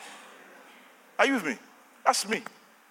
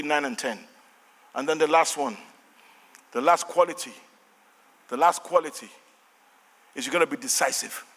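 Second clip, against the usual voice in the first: usual speaking voice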